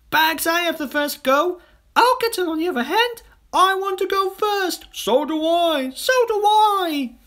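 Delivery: put-on high, loud children clamouring; excited squabbling. A narrator's reading. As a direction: kids fighting to go first. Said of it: "shouting with excitement".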